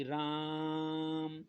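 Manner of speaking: teacher showing a long calling vowel; prolonged call. Shown as a demonstration. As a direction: call out राम with the आ held long. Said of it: "जैसे आप बोलिएगा".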